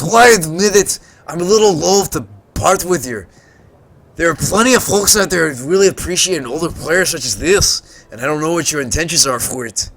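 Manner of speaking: put-on a well-meaning old salvager, hamming it up; gruff old tinkerer. Old salvager introduces himself to the visitor, gruff and friendly.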